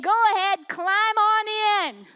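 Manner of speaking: loud, drawn-out enticing call; beckoning. Acted. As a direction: lure them in with a big, slow invitation. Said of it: "beckoning you in".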